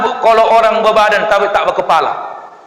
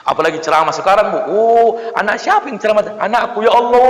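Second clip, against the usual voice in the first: ordinary delivery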